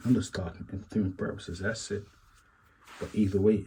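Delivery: usual speaking voice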